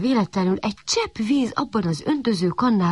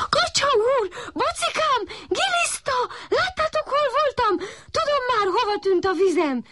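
very high, excited shout; puppy calling out happily. A narrator's reading. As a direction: announce it to everyone, delighted. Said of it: "kiáltott a kiskutya". (magas, boldog kiáltás)

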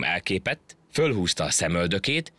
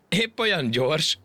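mocking; 10 semitones higher, slower than usual. A narrator's reading. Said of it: "kérdezte gúnyosan a majom".

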